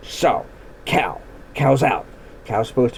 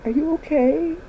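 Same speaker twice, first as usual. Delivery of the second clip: worried horse; 12 semitones higher, 3 dB quieter than usual. Retold or imitated. Horse worried about fallen rider.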